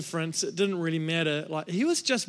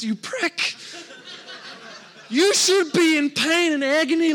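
mock outrage; 6 semitones higher, 4 dB louder than usual. Pastor acting out his resentment toward Glenn, hammed-up angry rant. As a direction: rant like a jealous little brother. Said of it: "it really ticked me off".

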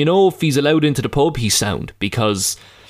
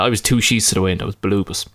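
ordinary delivery

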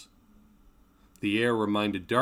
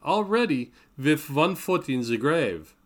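slow, higher, louder mock pity; teasing; 6 semitones higher, 5 dB louder than usual. A narrator's reading. Greta teasing the winded professor, high loud mock pity. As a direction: rib him with playful doom, slowly. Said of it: "she teased".

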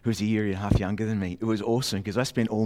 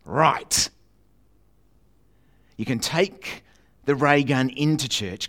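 a hint of a firm mother, higher and louder; stern mum; 4 semitones higher, 6 dB louder, slower than usual. Mum in the car park warning her son, firm. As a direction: lay down the rules from the front seat. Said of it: "Mum turns round and goes".

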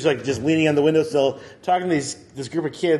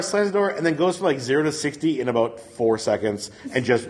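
normal talking voice

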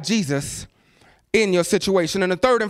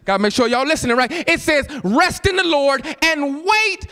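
preaching at full force; 6 semitones higher, slower than usual. Acted. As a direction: proclaim each verse like a preacher. Shouted scripture reading.

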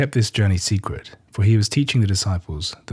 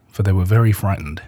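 ordinary delivery

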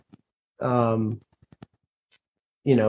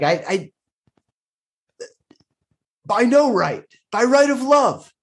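impassioned plea; 8 semitones higher than usual. Acted. Knight pleads with the lord, impassioned and rising high. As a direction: voice climbing high with passion. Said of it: "sound like, uh, like an impassioned speech".

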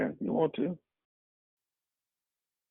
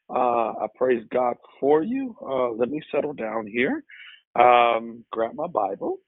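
normal talking voice

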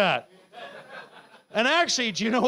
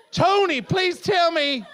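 ordinary delivery

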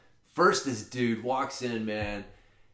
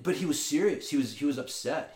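ordinary talk, nothing marked

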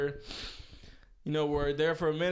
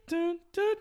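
mimicking beat; 13 semitones higher than usual. Shown as a demonstration. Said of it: "so you got the dun".

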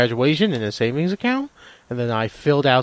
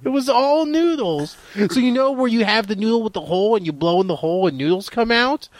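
high-pitched, overeager comic storytelling; put-on wacky enthusiasm; hammed-up excitement; 6 semitones higher than usual. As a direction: sell an absurd punchline with mock amazement. Hammed-up absurd noodle story.

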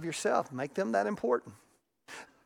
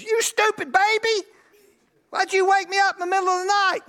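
hammed-up furious parent; mock angry yell; 12 semitones higher, 6 dB louder than usual. Pastor mimicking a parent yelling at a baby, high and loud.

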